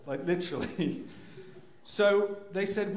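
normal talking voice

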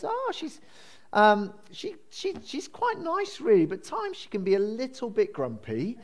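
lightly acted, high-pitched hedging with a wry smile. Security guard describing the Queen in front of her, wry. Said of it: "with a wry smile".

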